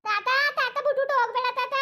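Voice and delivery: put-on high, tearful child begging; a little girl crying for grandpa to stay; crying plea. Granddaughter begs grandfather not to leave her, in a high crying voice.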